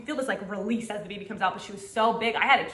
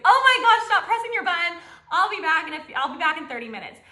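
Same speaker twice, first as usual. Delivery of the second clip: higher, louder mock doctor telling her off; exasperated doctor; 7 semitones higher, 4 dB louder than usual. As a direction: play the fed-up doctor, pitched up and loud.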